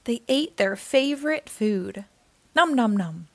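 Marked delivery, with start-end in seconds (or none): 2.5-3.3 playful chewing noise; munching sounds. A narrator's reading. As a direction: mime happy munching. Eating favorite food.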